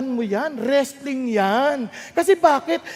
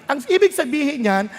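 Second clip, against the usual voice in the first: normal talking voice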